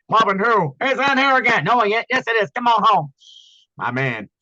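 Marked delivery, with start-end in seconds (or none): 0.1-3.1 high, loud manic Robin Williams voice; Robin Williams impression. Actor shows the interviewer his Robin Williams, high and loud. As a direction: burst into a zany Robin Williams riff.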